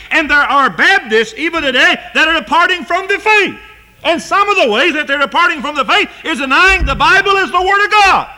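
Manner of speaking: preacher rising to a pulpit shout; full-force preaching. Real, not acted. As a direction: hammer it home to the congregation.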